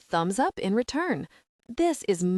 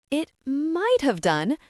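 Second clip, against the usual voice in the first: higher-pitched, defensive protest; 6 semitones higher, 4 dB louder than usual. A narrator's reading. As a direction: push back, pitched up and defensive. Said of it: "says Suze defensively".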